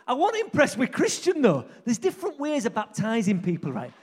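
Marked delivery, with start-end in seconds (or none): none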